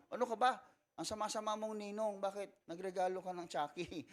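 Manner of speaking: high, indignant scolding of the godfather; mock scolding. Parent scolding the godfather for a Chucky gift, high and indignant.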